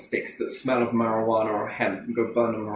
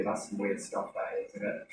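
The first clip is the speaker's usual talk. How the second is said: hushed aside; 4 semitones lower, 12 dB quieter than usual. Real, not acted. Trailing aside, much softer.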